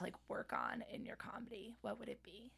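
hushed unvoiced question; whispering. Real, not acted. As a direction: whisper it softly.

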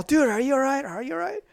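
high-pitched, urgent worry; acted-out alarm, pitch climbing; retold panic. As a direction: anxiously check on a downed friend. Retold anxious check on laughing friend.

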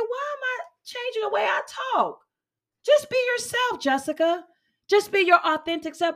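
high-pitched, retold talking-to herself; exasperated self-talk. Woman retelling how she questioned herself, high-pitched self-reproach. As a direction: pitch it up, chiding yourself.

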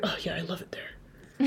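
hushed imitation of a disappointed man; retold upset. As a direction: quietly imitate someone let down. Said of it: "he was upset".

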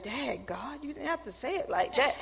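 soft, hushed reply to God; hushed protest. Retold or imitated. Preacher answering God, soft and hushed. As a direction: protest to God under your breath.

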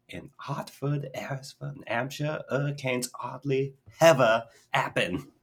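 high, slow, careful Cockney with missing H's; Cockney recital, the H landing on "ever"; dropped-H Cockney imitation. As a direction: mimic an actress fumbling her H's in Cockney. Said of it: "she can't pronounce her H's".

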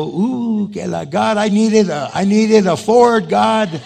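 high, loud pleading complaint; whining to God. Retold or imitated. Pastor complaining to God he needed a Ford, loud and high. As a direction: moan to God about the wrong motor.

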